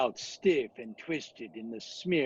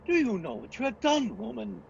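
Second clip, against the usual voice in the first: horrified outcry; 10 semitones higher than usual. Acted. Van Helsing crying out at Mina for freeing Dracula, horrified. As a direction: cry out in horror at her.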